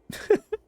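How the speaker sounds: sudden high-pitched giggle; high squeaky laugh. Real, not acted. Laughs at rejection.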